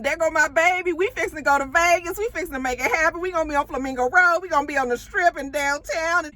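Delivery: high-pitched excited imitation, often fast; mock giddy sing-out of travel plans; smiling, gushing girlfriend. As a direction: gush happily as a doting partner. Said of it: "now she's smiling at him".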